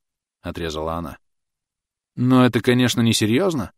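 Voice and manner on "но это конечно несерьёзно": higher, louder plea; pleading. A narrator's reading. Thomas pleading with Clotilde, higher and louder. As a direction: beg her to take it back. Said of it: "взмолился Томас".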